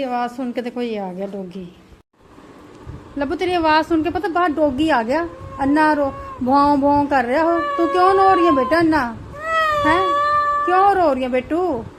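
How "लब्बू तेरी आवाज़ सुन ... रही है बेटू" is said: raised voice over crying. Real, not acted. Mother talks over crying baby.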